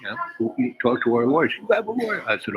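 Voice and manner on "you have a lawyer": mock alarm. Client alarmed.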